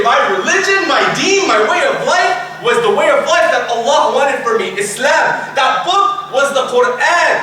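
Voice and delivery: high, emphatic testimony; proud proclamation. Acted. Soul answering about its religion, proud proclamation. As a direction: proclaim each phrase with conviction.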